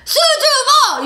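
very high, loud drawn-out yell; roaring concert chant; imitated crowd cheer. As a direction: bellow the fan response. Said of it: "그런 환호성".

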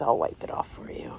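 soft, breathy whisper to the goat. Real, not acted. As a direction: whisper it like a secret. Tender aside to the goat.

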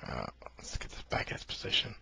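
low whispered aside. Real, not acted. Adjusting computer.

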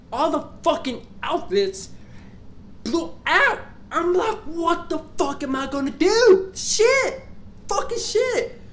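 frantic shout at the dead outlets; panicked outburst. Retold or imitated. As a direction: lose it as everything fails.

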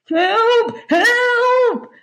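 high, loud drowning wails; gargled cries for help. A narrator's reading. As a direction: shout for help through gulps of water. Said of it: "they gargled and glugged".

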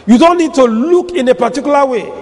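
ordinary talk

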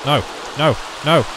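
ordinary talk

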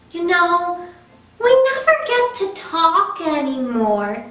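lightly voiced pleading pigeon, wheedling and bargaining; a cheeky pigeon stalling bedtime. Pigeon begs the child to let him stay up, lightly pleading.

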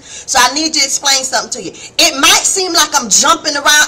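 ordinary delivery

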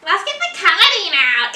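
slow, slurred drunk imitation. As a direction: drawl it like you're wasted. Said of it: "she was like".

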